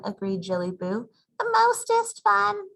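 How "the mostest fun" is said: high, slow little kitten voice; tiny sister voice. Acted. The youngest sister agreeing with her sisters, high slow baby voice. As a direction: stretch it out like the smallest kid.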